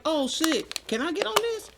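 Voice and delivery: imitating an eager ask, pitched well up; excited retold request. Storyteller voicing the rapper asking onto the song, excited. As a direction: lift it high, like someone angling to join in.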